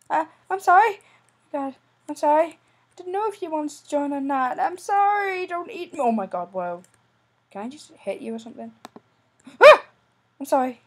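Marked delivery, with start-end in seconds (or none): 0.1-6.0 high, loud frantic sorry; panicky apology. Real, not acted. Begging the zombie at the door, panicky high apology. 9.6-9.8 high, loud frightened scream; startled scream. Real, not acted. Zombie lunges at him, startled scream.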